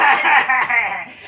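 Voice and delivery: loud shouted villain laugh; mock evil shouting laugh; evil cackle. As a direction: cackle like a cartoon heel.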